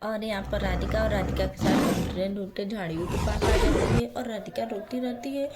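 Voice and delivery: story narration. A narrator's reading. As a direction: narrate the scene calmly and low.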